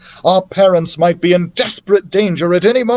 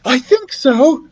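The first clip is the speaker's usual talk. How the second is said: put-on young girl voice; 10 semitones higher, slower than usual. A narrator's reading. Beatrice voiced in story.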